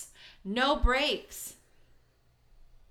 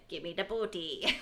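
lower, softer mock pirate demand; pirate quip; 4 semitones lower, 6 dB quieter than usual. Podcaster retells answering Tyler as a pirate, in lightly put-on voice. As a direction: make a half-hearted pirate demand.

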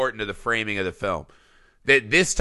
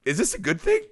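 high-pitched wondering question, a bit softer; inner question; 3 dB quieter, faster than usual. Retold or imitated. In his own head, wondering about the bully, high and soft. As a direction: ask it up high like a guilty thought. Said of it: "in my head, I'm like".

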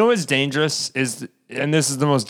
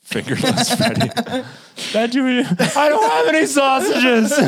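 high voice through laughter; giggling while mocking; laughing; 10 semitones higher than usual. Teasing Tom about lost fingers, laughing high.

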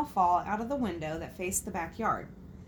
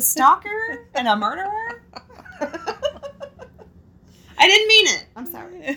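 laughing; 11 semitones higher, 9 dB louder than usual. Real, not acted. Laughing about Bigfoot.

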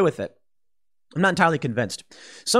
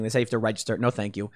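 normal talking voice

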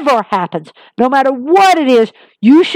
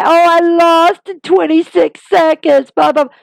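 mock crybaby whine; 6 semitones higher than usual. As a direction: whine it out like a pouting loser. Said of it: "and cry".

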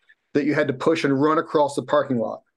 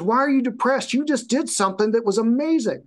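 higher, incredulous trainer voice; imitating baffled trainer; 8 semitones higher than usual. Trainer asks his client why he is down, incredulous. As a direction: ask it like you can't believe it.

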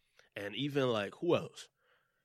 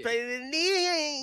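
high, loud hyped-up rap ad-lib voice; mock rapper ad-lib; 11 semitones higher, 4 dB louder than usual. Riffing to friends about his imagined music, mock rapper ad-lib. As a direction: ham it up like a trap rapper on a hook.

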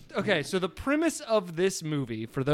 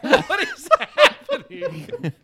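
baffled; 9 dB louder, much slower than usual. Real, not acted. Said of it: "baffled reaction".

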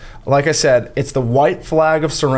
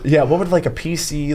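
ordinary talk

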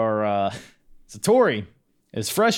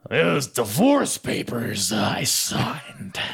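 slow, growled boss voice, mock McMahon; a badly faked Triple H that comes out as Vince; 4 semitones higher, slower than usual. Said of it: "I just turned into Vince McMahon".

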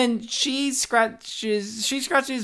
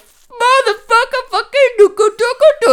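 brain-damaged babble; 11 dB louder than usual. Retold or imitated. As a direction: babble like a broken toy. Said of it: "he's like".

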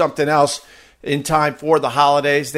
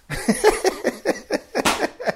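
high breathless laugh; 3 dB quieter than usual. Real, not acted. Laughs at own flub.

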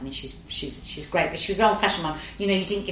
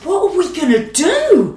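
panicked; 9 semitones higher, 8 dB louder than usual. Retold or imitated. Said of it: "we're like".

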